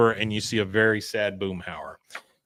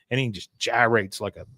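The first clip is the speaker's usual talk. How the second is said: ordinary talk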